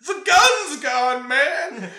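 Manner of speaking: loud, drawn-out yell; slow panicked yell. Retold or imitated. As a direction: wail it out slowly and loudly. Stu panicking about the gun.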